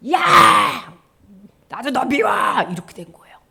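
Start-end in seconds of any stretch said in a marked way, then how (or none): 0.1-2.3 loud acted angry yell; exploding at someone, then spitting it out; hateful shout back. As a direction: snap back in a burst of mock hatred. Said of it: "백성들을 미워하니까 선지자도".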